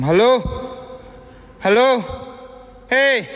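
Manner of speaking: ghost hunter calls out to unseen voices, rising to a shout; calling out. Real, not acted. Calling into dark building.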